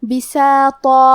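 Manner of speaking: بساطا with a thick, full ṭa and long a; heavy taa. Shown as a demonstration. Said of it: "موٹی آواز میں پڑھیں گے".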